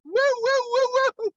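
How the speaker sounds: very high repeated woo-woo; imitated whooping. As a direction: hoot a comic high sound effect. Imitated sound after the parting.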